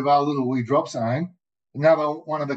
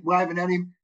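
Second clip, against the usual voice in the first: ordinary talk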